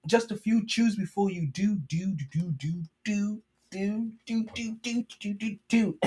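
bouncy sing-song pitch for the pill; rhymed ad read. Acted. Podcast host reads sponsor spot as playful jingle. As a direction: sell it like a jingle, lightly sung at the end.

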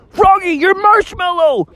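very high, urgent shouted warning; Bubby's warning shout. Acted. Bubby shouting at Froggy that his marshmallow is burning. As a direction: yell to snap him out of it. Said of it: "that's when Bubby was like".

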